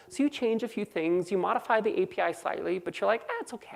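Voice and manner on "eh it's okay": high, soft, careless brush-off; dismissive shrug. Acted. Programmer reassuring himself after a small API change, dismissive. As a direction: wave the worry away lightly.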